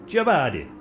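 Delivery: badly faked Italian accent on Giobadi; terrible Italian accent. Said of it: "My terrible impression of an Italian accent".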